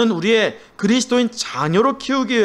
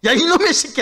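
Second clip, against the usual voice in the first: mock yell; 8 semitones higher than usual. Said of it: "욕하고 때려요".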